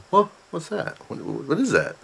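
high, puzzled pet voice; dog's puzzled voice. Retold or imitated. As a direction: voice the dog, curious and confused. The dog Gus voiced, cocking head.

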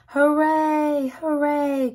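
high, loud joyful cheer; cheering hooray. A narrator's reading. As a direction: cheer as the train reaches the top. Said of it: "cried the little clown".

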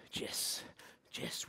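barely voiced mock-mystic whisper; hushed bonding whisper. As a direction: breathe the merged name softly. Whispered merged name bit.

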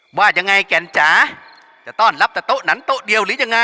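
rowdy shouting. Acted. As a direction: holler mockingly from the table. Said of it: "ส่งเสียงอึงมาอีก".

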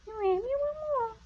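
high cooing question; baby talk to dog. Acted. Owner asking her dog while it eats, high baby talk. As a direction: coo sweetly at the dog.

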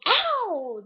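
high, loud "ow" of pain; loud yelp. Pretend. Vlogger burning fingers on the bars, loud yelp. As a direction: yelp as if burnt.

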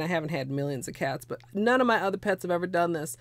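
ordinary talk, nothing marked

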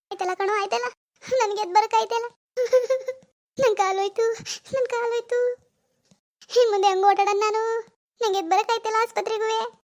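high, wailing helplessness; hammed-up howl of pain; put-on crying. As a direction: refuse help with theatrical sobs. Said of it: "ಕಾಲ್ ನೋವು ಅಂತ ಅಳ್ತಿದ್ದಾರೆ".

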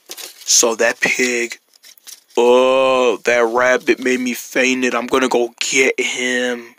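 low, slow pig voice; menacing pig. Acted. Zombie pig vowing to get the rabbit, menacing. Waking pig vows revenge.

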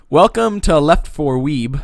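slow loud welcome; announcer intro. Acted. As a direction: greet the audience like a host. Show intro greeting.

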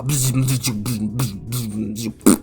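repeated playful buzzing noises; lightsaber buzz. Pretend. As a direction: buzz like a kid swinging a toy saber. Child playing with figures. (dziecięce bzyczenie miecza)

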